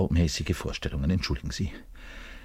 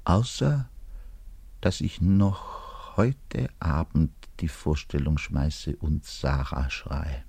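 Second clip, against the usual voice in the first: ordinary talk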